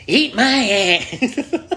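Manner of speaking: very slow, high, rising odd voice; slow, high goofy voice; weird voice. Real, not acted. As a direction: stretch it out in a silly voice. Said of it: "I don't even know what voice that is".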